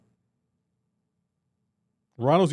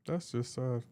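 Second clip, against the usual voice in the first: softer, deflated; mock pitying; 4 semitones lower, 12 dB quieter, slower than usual. Real, not acted. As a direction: murmur it in pity. Repeated pitying verdict.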